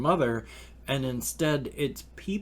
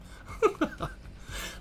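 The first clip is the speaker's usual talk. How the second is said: breathy nervous laugh; 5 dB quieter than usual. Real, not acted. Nervous laugh after sad talk.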